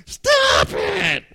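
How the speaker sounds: high, loud shriek of an annoyed sister; sister's shout. Retold or imitated. Sister yelling at brother drumming on her. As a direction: yell it like a kid sister fed up with the noise.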